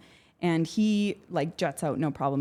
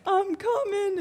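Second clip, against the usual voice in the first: much higher, bright and eager call; chirpy eager squeal; 4 dB louder, slower than usual. Retold or imitated. Naive newbie calling to the surfers, high eager squeal. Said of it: "and I'm like".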